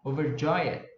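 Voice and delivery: slow teacher's demo of overjoyed, stressed on JOY; stress on third syllable. Shown as a demonstration. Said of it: "Você dá uma subida no".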